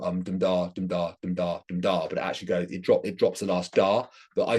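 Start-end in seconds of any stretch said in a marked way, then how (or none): 0.2-2.1 even "dum da" run, stress on "dum"; trochaic beat. Shown as a demonstration. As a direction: chant "dum da" with the first syllable weighted. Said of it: "So it should go".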